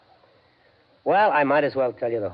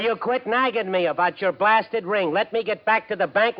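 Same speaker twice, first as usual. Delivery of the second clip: louder, higher exasperated retort; irritated shouting; 10 semitones higher, 4 dB louder than usual. Acted. Husband snapping at nagging wife, loud and irritated. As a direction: bark back, fed up with her. Joe snaps back at Molly.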